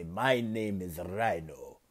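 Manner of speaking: put-on slow, low Russian-accented villain line. A villain names himself to Deku, slow Russian accent. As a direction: Rhino announcing himself in a heavy Russian accent. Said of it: "I will try my best to do a Russian accent".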